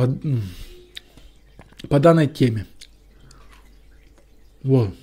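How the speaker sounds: chewing while talking; biting a cookie mid-sentence; mouth full of cookie. Real, not acted. Seen 0: Holds cookie at lips, bites and chews throughout labelled words.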